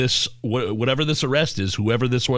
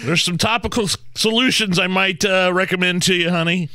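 high, louder mock doctor; 9 semitones higher, 3 dB louder than usual. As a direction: play a smarmy doctor, higher. Playing the doctor to him.

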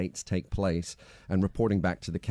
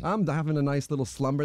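ordinary talk, nothing marked

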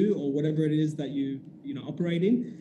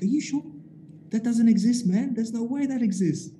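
can't believe what he's hearing; disbelief; 7 semitones higher than usual. Retold or imitated. Friend doubting the German shisha, high and incredulous.

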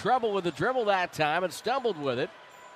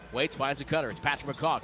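ordinary delivery

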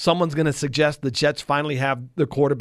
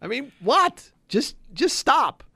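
much higher, incredulous plea; exasperated; 10 semitones higher, slower than usual. Real, not acted. Co-host fed up with preseason overreaction, exasperated. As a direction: pitch it way up, then drag out the plea.

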